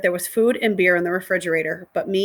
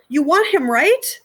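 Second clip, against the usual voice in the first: put-on pleading girl; 8 semitones higher, 5 dB louder, slower than usual.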